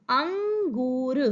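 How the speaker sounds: अंगूर slowly as अं, then a long गूर; syllable by syllable. Shown as a demonstration. Teacher spelling the picture's name for children, अंगूर drawn out. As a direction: stretch both the nasal and the ऊ in अंगूर. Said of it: "अंगूर को हम लिखेंगे कैसे".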